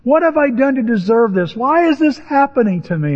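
high, aggrieved plea; Moses praying in protest. Acted. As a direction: plead with God, wronged and rising in pitch. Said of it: "he prayed".

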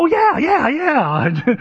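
high-pitched, hammed-up eagerness; gushing agreement. Acting a keen, emphatic reply.

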